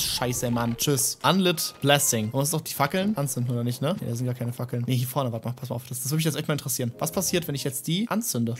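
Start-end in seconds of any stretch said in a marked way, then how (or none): none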